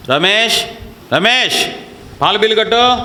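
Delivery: scornful mock wife shouting for her husband; mock wife calling husband. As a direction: call out Ramesh like a bossy wife.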